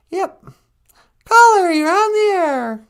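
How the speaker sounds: high, loud on-air announcer call; booming DJ announcement; radio host intro. Acted. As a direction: bring the caller on air.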